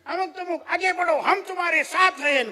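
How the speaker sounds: high, loud mock rallying cry of hollow supporters; mocking fake cheerleaders. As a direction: ham up the empty "we're with you" promise. Mocking false friends' encouragement. (खोट्या साथीदारांची उंच, मोठ्या आवाजातली चेष्टेची घोषणा)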